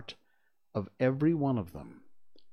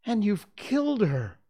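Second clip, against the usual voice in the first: put-on high, louder boy's accusation; accusing child voice; 12 semitones higher, 5 dB louder than usual. A narrator's reading. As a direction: blame him like a hurt child. Twin accusing.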